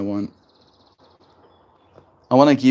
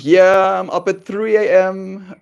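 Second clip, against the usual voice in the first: too much caffeine; 8 semitones higher, 5 dB louder, slower than usual. Real, not acted. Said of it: "I'm so caffeinated right now".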